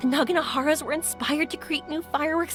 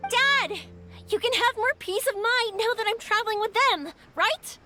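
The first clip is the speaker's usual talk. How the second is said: calling to dad; 7 semitones higher than usual. Acted. Calls out to her father.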